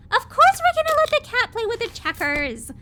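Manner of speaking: bright emphatic exclamation; excited. Real, not acted. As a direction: insist it playfully, voice up. Mock-outraged defence of cat.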